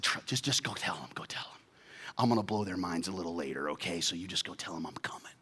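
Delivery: soft, half-whispered; quiet conspiratorial urging; hushed reassurance. Acted. Jesus quietly urges Mary to tell the disciples.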